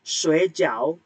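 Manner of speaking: "Sui jiao" with a dipping tone, drawn out; nada ketiga. Shown as a demonstration. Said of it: "nada tiga". ("Sui jiao" nada tiga, rendah lalu naik)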